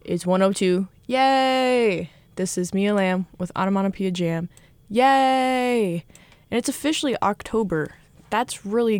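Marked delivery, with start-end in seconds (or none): 1.1-2.1 excited cheer. Real, not acted. DJ cheering on air. 4.9-6.1 excited cheer. Real, not acted. DJ cheering again on air.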